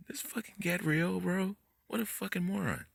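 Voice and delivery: high-pitched, incredulous disgust; real exasperation, voice pitched high; exasperated. Real, not acted. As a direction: vent in disbelief, pitch riding way up. Exasperated outburst at someone he scorns.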